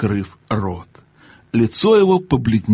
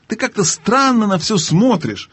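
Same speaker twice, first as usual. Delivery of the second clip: raised, exasperated voice; impatient; 10 semitones higher than usual. A narrator's reading. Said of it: "в нетерпении повысил голос".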